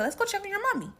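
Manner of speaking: put-on high sing-song to the baby; baby talk. Carrying the baby upstairs, high sing-song coo. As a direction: coo it to the little one.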